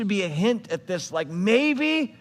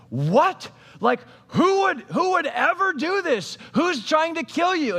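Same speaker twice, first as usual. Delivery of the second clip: much higher, stunned and indignant; a king suddenly shocked, voice jumping up; shocked outrage; 9 semitones higher than usual. Retold or imitated. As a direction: react as if you can't believe it.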